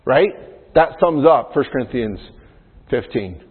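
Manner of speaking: ordinary talk, nothing marked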